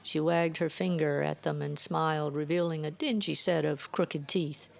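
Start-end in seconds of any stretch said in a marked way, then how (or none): none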